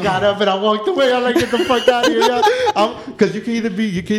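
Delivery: laughing, high and loud; high, loud laughing exclamation. Retold or imitated. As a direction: crack up while saying it. Fleeing, laughing retelling.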